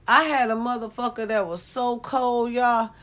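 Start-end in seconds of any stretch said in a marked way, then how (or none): none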